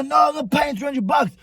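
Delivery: high-pitched retold protest; acted-out indignant objection; indignant refusal. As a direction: refuse outright, re-enacting the argument. Acting out his refusal to pay.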